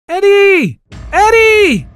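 very high, loud calling voice; screaming mother. A narrator's reading. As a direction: scream the child's name in a high pitch. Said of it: "my mom's voice screaming my name".

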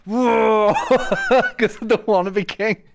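high, loud laughing cry; laughing outburst. Pretend. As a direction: burst out laughing in character.

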